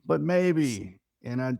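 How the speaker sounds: ordinary delivery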